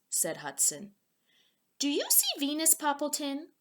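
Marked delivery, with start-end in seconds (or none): 1.8-3.5 higher, eager asking a friend; bright eager question; excited higher question. Acted. Hudson eagerly asking his friend.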